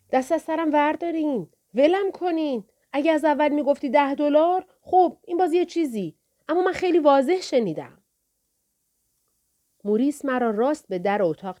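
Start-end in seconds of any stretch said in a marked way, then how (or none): 0.1-8.0 put-on high, pleading boy, louder; a scared teen pleading with the pimp; frightened pleading. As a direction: beg them to back off. Boy begs thugs to leave.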